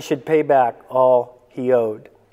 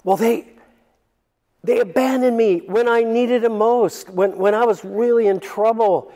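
whining complaint; 7 semitones higher than usual. Retold or imitated. Listener complains to the Lord in a high, wounded whine. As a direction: protest like someone who feels wronged. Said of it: "going where I went".

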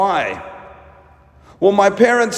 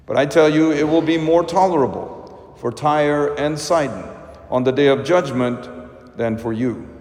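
solemn, lower liturgical proclamation; Gospel reading; 4 semitones lower than usual. Acted. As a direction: read it like a priest at the altar.

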